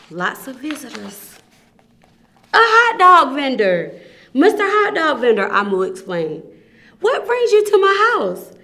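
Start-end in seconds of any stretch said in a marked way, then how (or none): 0.1-1.4 soft, hushed reveal. Real, not acted. Storyteller suspense. 2.5-5.5 louder, higher announcement; announcing. Acted. Announcing the new visitor. 7.0-8.5 much higher and louder question; high bright greeting. Acted. Amu voiced greeting visitor.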